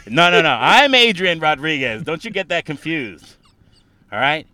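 loud boasting impression of Adrian Rodriguez; Adrian Rodriguez bit. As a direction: own the stage like a comedian's bit. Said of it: "steal everything about Adrian Rodriguez".

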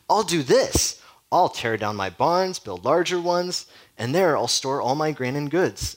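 hammed-up gloat over barns and grain; gloating rich man. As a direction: lay out the plan as a self-satisfied rich man. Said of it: "gloating to himself".

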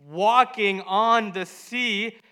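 loud, high storytelling peaking in a scream; big stage storytelling ending in a yell; dramatic build to scream. A narrator's reading.

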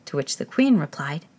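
ordinary delivery

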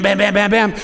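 ordinary talk, nothing marked

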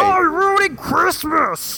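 high, slow mock outrage; outraged mock whine. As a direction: whine like an offended shopper. Mocking outraged people.

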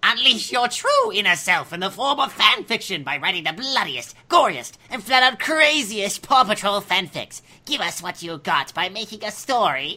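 a slipping, laughing creepy read; creepy voice cracking into giggles; creeper voice. Shown as a demonstration. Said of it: "in my creeper voice".

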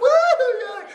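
loud, high-pitched woo; party whoop. Retold or imitated. Fun uncle arrives at the birthday party, big whoop.

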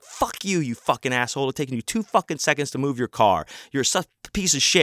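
high, fast cursing rant at normal volume; retold angry outburst. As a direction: re-enact chewing someone out. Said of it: "I fucking went off on him".

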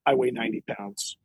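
low, quiet self-assurance; lightly voiced tough dog, understated threat; unimpressed big dog. Big dog squares up to raccoon.

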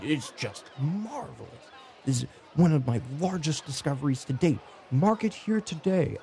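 eager naturalist, rising in excitement; gushing monster scholar. Acted. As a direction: proclaim a great discovery with delight. Game master plays Volo.

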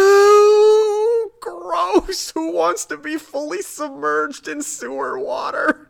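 very high, loud, squealing disgust; grossed out. Retold or imitated. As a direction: recoil from something nasty. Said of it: "But emotionally, I'm like".

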